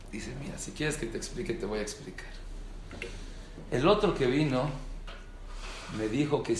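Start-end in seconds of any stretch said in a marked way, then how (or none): 0.4-2.3 softer, lower, quick reply; calm explaining voice. Retold or imitated. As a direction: settle him down calmly. El donante responde calmado. (voz del donante, más baja y suave)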